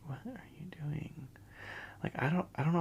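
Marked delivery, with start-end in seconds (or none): 0.1-1.3 breathy stunned whisper, pitched up; a mock-horrified whisper at the screen; whispered disbelief. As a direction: hush it out in disbelief, barely voiced. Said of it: "I'm like".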